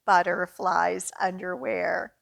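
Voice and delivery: lively picture-book read-aloud; reading to children. Acted. Librarian reading a picture book to children at story time. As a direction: read it out brightly for little kids.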